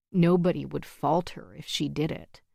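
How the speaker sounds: plain reading voice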